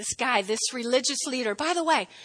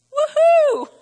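high, loud whoop of delight; excited whoop; 13 semitones higher, 8 dB louder than usual. Real, not acted. Preacher cheering Jesus' answer, loud whoop. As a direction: cheer him on like a fan.

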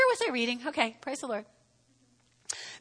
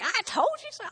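a hint of a smug higher spouse; teasing spouse; 9 semitones higher, 5 dB quieter than usual. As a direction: lightly mimic the spouse's told-you-so. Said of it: "your spouse is like".

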